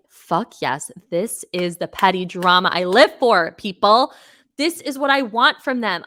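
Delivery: loud, emphatic delight, pitch climbing at the end; gleeful exclaiming. Retold or imitated. Fan reliving their joy at petty drama, loud excited outburst. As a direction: exult loudly, like a fan getting their wish. Acting out own delighted reaction.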